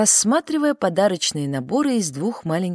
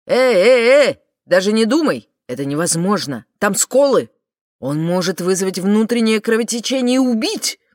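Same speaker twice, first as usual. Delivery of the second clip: loud, urgent warning, higher; alarmed shout; 3 semitones higher, 7 dB louder than usual. Acted. Ferhan stops her grabbing crystal.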